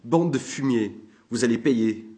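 put-on enraged drunk sergeant shouting; angry shouting. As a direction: keep yelling the threat. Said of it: "hurla-t-il".

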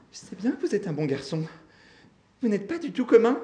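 high, insistent assurance; plead, pitched well up; desperate pleading. A narrator's reading. Grandmother flattering the Misfit, desperate pleading.

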